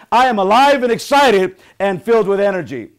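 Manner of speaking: loud, high motivational chant; rousing affirmation. Shown as a demonstration. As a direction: belt it out to fire up the group. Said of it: "I want you to repeat after me".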